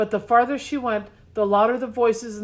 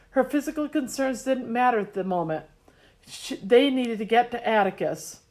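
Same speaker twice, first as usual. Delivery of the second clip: ordinary delivery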